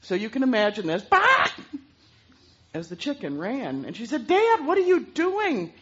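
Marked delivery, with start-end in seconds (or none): 1.0-1.5 loud startled chicken squawk. Acted. Panicked chicken squawking at the farmer. 4.3-5.7 put-on high, slow, loud little girl's cry; alarmed girl. Girl shocked at her dad chasing the chicken, high alarm.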